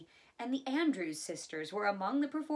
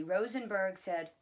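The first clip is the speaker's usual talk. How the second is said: ordinary delivery, nothing marked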